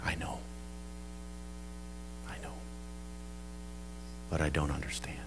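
retold through tears, barely audible; very soft, low, halting with long pauses; tearful. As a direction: murmur it as if weeping. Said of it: "in the tears".

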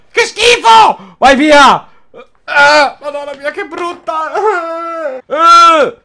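very high, slow, loud shrieks; disgusted shriek. Real, not acted. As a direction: squeal in disgust and fright. Disgusted by huge moth, shrieking.

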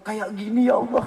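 half-joking, loud eager plea; eager pointing plea. Retold or imitated. Young man at the Kaaba points out a woman, half-joking loud plea. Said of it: "langsung dikasih contoh".

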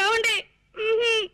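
high whimpering appeal; tearful pleading. Acted. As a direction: whimper it, begging him to promise. Wife still begging her husband. (ఏడుపు గొంతుతో బతిమాలడం)

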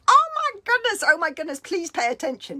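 high, loud, panicky little voice; frantic baby voice. Acted. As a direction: squeak it out in alarm. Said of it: "there's the baby going".

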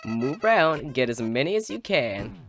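loud, lilting cartoon flower. Talking flower instructing the player, loud lilting voice. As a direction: call it out with big cheery swoops. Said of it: "I gotta give a voice".

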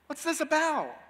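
high, puzzled disbelief; a king who can't work out how they knew; scratching his head in confusion; baffled king. Retold or imitated. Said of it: "he's left scratching his head".

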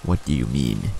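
lightly voiced low monster; a touch of deep monster voice; deep crackly monster. A narrator's reading. Monster questions the doubting child, lightly deep and crackly. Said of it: "deep and crackly".

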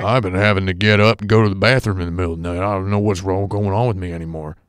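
put-on fast, rambling old Midwestern man. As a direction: do a grumbling Midwestern grandpa. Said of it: "I love your old man impression".

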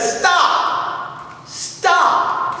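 high breathy cry of stop; director stopping her. Retold or imitated. Broadway director halts the audition, high cry. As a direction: cut the singer off sharply, high. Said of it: "the director said".

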